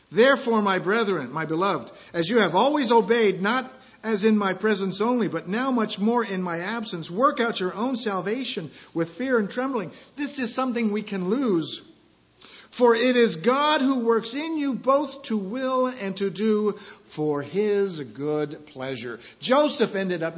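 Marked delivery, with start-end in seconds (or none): 0.1-9.9 loud, raised proclaiming of scripture; scripture reading. Acted. Pastor reading Philippians to church, loud proclaiming. Reads scripture aloud in sermon. 12.7-19.2 loud, raised opening then slowing down; scripture reading. Acted. Pastor reading scripture to church, raised then slowing. Reads scripture aloud in sermon.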